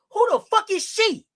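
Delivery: mock quote. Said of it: "Sophie said".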